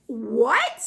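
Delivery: incredulous, stretching the words out; shocked disbelief. Retold or imitated. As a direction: retell a stunned reaction. Retold shocked reaction to wife.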